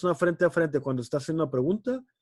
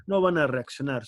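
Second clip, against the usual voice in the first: ordinary talk, nothing marked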